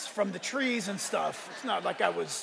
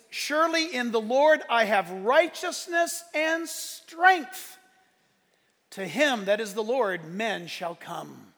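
preaching scripture; 4 dB louder, slower than usual. Acted. Pastor reading Isaiah to the church, slow. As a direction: proclaim it slowly.

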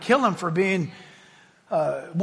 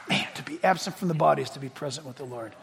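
soft, low, fast and unbothered; calm Paul; 8 semitones lower, 9 dB quieter, faster than usual. Retold or imitated. Paul answering his persecutors, soft low unbothered. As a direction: toss it off low and easy. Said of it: "And Paul says".

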